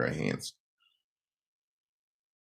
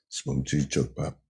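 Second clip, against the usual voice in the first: a lower, softer put-on voice for the friend; Al's voice; 11 semitones lower, 7 dB quieter than usual. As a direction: drop low and hand it over like the buddy did. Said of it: "It's like".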